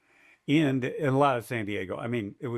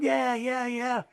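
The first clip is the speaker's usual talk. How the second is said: imitating crowd cheer; 11 semitones higher, much slower than usual. Crowd cheering at finish line.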